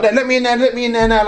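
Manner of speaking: high-pitched, urgent mock demand; insistent imitation, not shouted; retold demand. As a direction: act out pushing to get in. Retold man demanding entry to cell.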